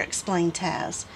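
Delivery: ordinary delivery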